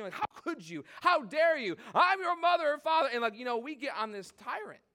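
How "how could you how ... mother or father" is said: rising, louder mock outrage; hammed-up parental fury; huffy parent. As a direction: play the indignant parent getting worked up. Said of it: "huffy puffy".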